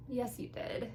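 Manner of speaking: normal talking voice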